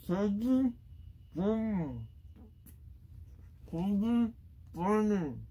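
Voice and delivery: garbled through a packed mouth; barely able to speak, mouth crammed; cheeks stuffed full. Real, not acted. Mouth packed, words barely come out.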